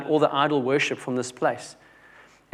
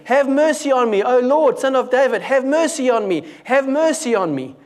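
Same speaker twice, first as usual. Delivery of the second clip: loud shriek; 8 semitones higher, 4 dB louder than usual. Shown as a demonstration. Preacher acts out the woman begging Jesus, loud shrieking cry. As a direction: shriek it out as a desperate plea. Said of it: "Continuing again and again and again".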